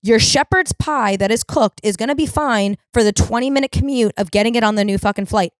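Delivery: ordinary talk, nothing marked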